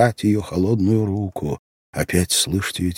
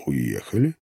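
low, soft questioning echo; hushed disbelieving question; stunned repetition; 6 semitones lower, 7 dB quieter than usual. A narrator's reading. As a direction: repeat it softly, low, rising into a question. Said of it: "повторил я".

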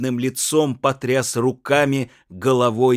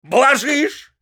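much higher, louder yell; shouted; 14 semitones higher, 8 dB louder than usual. A narrator's reading. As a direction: scream the reproach at her. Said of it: "закричал супруг". (крик мужа)

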